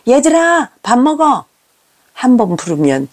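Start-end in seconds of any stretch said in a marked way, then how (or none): 0.1-1.5 put-on high-pitched calling out to the kids; raised-voice call. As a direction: call the children to dinner. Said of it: "소리 높여 부릅니다".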